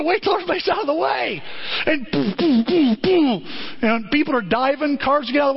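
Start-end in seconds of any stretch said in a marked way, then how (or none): 2.1-3.4 punchy booms; mimicking Bert firing the gun, punchy; gunshot sounds. Imitating gunshots.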